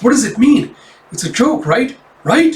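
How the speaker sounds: high, pleading, climbing to the last word; anxious, voice rising sharply; desperate disbelief. Retold or imitated. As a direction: imitate someone begging for reassurance. Family desperate for it to be joke.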